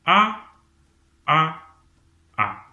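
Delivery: first "A" very high and loud, then stepping down; descending A steps. Shown as a demonstration. Teacher repeating the A staircase for students to practise. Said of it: "Essayez de vous entraîner".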